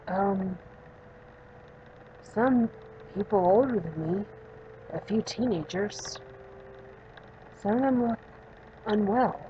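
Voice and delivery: subdued, lightly acted patient character; a hint of a withdrawn young patient; lightly voiced young patient. Patient describing the girl she sees, subdued character voice.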